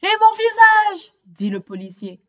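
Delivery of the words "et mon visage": high, loud indignant cry; loud complaint. Acted. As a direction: protest about his face. Policeman complains.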